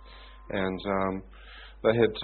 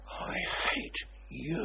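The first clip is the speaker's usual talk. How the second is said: slow, voiceless hiss of hatred; lightly voiced menacing warlock; warlock's hiss; 7 semitones higher, 8 dB quieter, slower than usual. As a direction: breathe the hate out slowly. Warlock glaring, cursing him.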